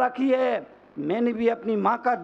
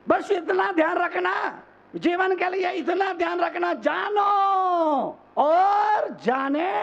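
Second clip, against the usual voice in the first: high-pitched, full-force preaching; impassioned exhortation; 6 semitones higher than usual. Real, not acted. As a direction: drive the lesson home to the whole crowd. Preacher drives the lesson home. (ऊँचे सुर में जोशीला प्रवचन)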